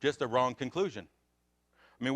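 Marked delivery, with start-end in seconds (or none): none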